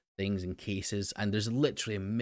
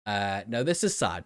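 ordinary delivery

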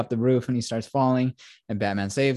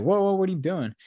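startled call; 9 semitones higher than usual. Retold or imitated. Cops find Catwoman beating Kenzie.

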